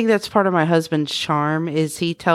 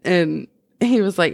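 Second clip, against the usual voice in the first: ordinary talk, nothing marked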